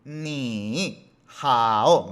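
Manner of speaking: NI dips and rises, then HAO dips and rises; overdone full dip on both "ni" and "hao"; each of "ni hao" heavily dragged down and up; two full third tones. Shown as a demonstration. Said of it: "se lee así".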